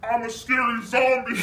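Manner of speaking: growling zombie on sulfur hexafluoride. Shown as a demonstration. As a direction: say it through heavy gas, then crack up. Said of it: "take a hit of what's called sulfur hexafluoride".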